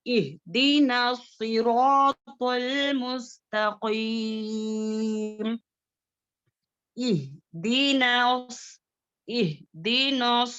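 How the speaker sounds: very slow, drawn-out Quran recitation. Shown as a demonstration. Teacher reciting the verse for pupils to repeat. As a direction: chant it out for pupils to follow. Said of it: "आप मेरे साथ पढ़ें".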